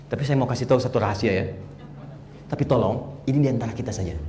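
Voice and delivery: soft, low confidential aside; confiding a secret. Pretend. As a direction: let them in on a secret, softly and low. Shares a secret with audience.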